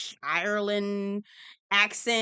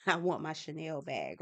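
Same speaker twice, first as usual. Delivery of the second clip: soft, lower-pitched restatement; subdued; 5 semitones lower, 7 dB quieter than usual. Real, not acted. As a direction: say it quietly, keeping it down for someone next door. Repeats it subdued, boyfriend working nearby.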